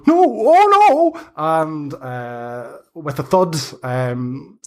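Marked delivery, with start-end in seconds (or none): 0.1-1.2 high, loud, slow wail as the branch snaps; old witch yelping as she falls; panicked hag cry. Acted. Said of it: "she goes".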